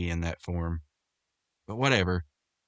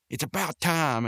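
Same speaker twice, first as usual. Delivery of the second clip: higher-pitched imitation of a rude retort; Rigby being jerky; 8 semitones higher than usual. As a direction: act out an ungrateful jerk, pitched up. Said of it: "being the jerk he is".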